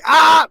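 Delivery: high, loud yelp; loud scream demo. Shown as a demonstration. Host demonstrating the scream, loud yelp. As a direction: show the yell at full volume. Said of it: "but quietly?".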